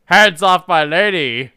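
loud, slow declaration; mock heroic shout. Invisible man yelling at the couple, loud and slow. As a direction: defend her like a hammy hero. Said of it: "invisible man's voice".

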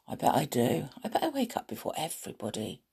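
hushed, secret whisper to herself. Real, not acted.

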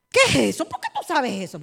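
retold indignant questioning; mock startled mother. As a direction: act out a mother caught off guard. Mother startled by nosy child.

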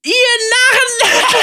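hoher, lauter Schurkenspott; mustache twirling villain. Acted. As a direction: diabolisch triumphieren. Villain gloats, laughing. (triumphierender Bösewicht)

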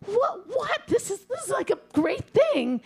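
very high, bewildered squeal; baffled protest. Acted. Punched husband defending himself to his brother, baffled protest. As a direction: protest in high-pitched disbelief.

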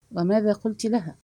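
usual speaking voice

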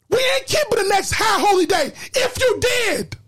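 impassioned preacher driving each point home; full-force preaching. Real, not acted.

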